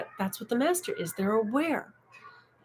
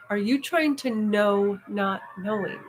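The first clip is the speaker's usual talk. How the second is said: slow, deliberate repeat; slower than usual. Shown as a demonstration. Speaker replaying the teacher's question for listeners, slow and deliberate. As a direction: spell the question out slowly so it sinks in. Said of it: "Listen to that".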